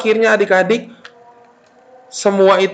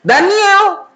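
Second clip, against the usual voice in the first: put-on high-pitched call to a child; parent calling; 12 semitones higher than usual. Parent calls a child away from games, calling out. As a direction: call the child's name firmly. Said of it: "Kalau papa mama bilang gini".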